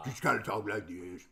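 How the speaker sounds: lightly voiced low Okor. Shown as a demonstration. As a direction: drop into a hint of Okor's voice. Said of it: "Okor's like this".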